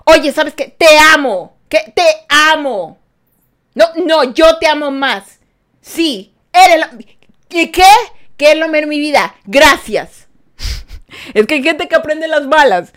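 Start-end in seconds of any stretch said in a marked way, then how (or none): 0.0-10.3 a lightly hammed-up burst of love, louder and higher; loving but loud. Showering a grumpy person with loud loving praise. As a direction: gush it out loudly like a mock sweet talker. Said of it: "maltrátalos con amor".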